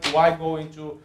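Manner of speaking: ordinary delivery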